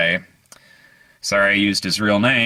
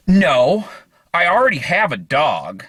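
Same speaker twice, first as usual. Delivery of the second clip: higher and louder, oddly cheerful; patient answering the pregnancy question, higher, brightly odd; matter-of-fact patient; 7 semitones higher, 3 dB louder than usual. Retold or imitated. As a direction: blurt it like it makes sense. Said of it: "And she says".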